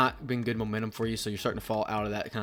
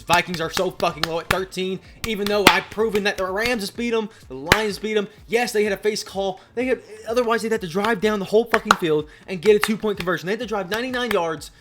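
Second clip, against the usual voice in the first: higher, louder, worked-up arguing; heated rant; 7 semitones higher, 6 dB louder than usual. Real, not acted. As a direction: argue your case with rising exasperation. Heated rant at Vikings fans.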